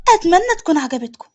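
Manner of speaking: plain reading voice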